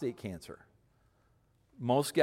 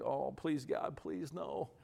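hushed, rising mock plea; quiet little squeamish prayer; squeamish pleading; 2 semitones higher, 7 dB quieter, slower than usual. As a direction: plead softly in mock dread. Squeamish man pleading to be spared.

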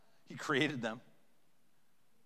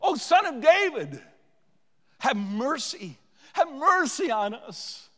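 loud, high, stretched cry for mercy; desperate shouted appeal; 12 semitones higher, 10 dB louder, slower than usual. Acted. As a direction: beseech with all your voice. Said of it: "Those guys cried out".

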